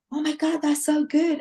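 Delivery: high, excited gush; a light imitation of an excited sister. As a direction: squeal it like a thrilled sister. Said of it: "They were, like, so excited".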